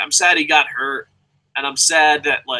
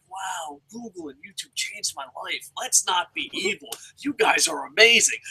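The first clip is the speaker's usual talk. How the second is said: high, much softer syrupy fake flattery; fake-nice whisper; 4 semitones higher, 6 dB quieter than usual. Plotting fan flattering a Google employee in a syrupy whisper. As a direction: coo it sweetly like a con. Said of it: "I'm like".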